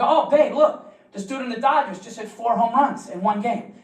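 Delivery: put-on nervous boyfriend hyping baseball to his girlfriend; forced excitement. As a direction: cover nerves with hype.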